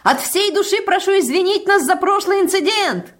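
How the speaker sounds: high, loud, effusive calling; shouted apology. A narrator's reading. Dixon apologising from afar, effusive shouting. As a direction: cry out an apology across a distance. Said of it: "ещё издалека закричал Диксон".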